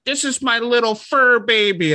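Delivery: high, cooing pet-parent voice; mock-gush about your precious little pet; gushing dog owner. Mocking dog owners' talk, high cooing gush. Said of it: "someone's like".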